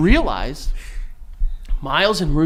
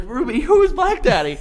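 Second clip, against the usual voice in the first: asked through giggles, pitched up; laughing; 14 semitones higher than usual. Real, not acted. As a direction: barely keep it together. Retelling, laughing at punchline.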